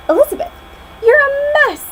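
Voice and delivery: high, loud, disdainful prince voice; the prince scolding Elizabeth for her looks; snooty prince. Acted. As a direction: look down your nose at her.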